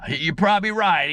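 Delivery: mock rueful friend; imitating friend's reply. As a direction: concede like the hooked guy. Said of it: "He said".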